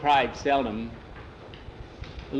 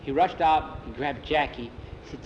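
ordinary delivery, nothing marked

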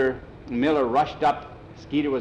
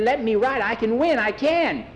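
put-on high, pleading boy's voice; desperate kid imploring; begging; 10 semitones higher, 4 dB louder than usual. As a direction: beg for the chance, pitched way up. Skeeter begs to ride.

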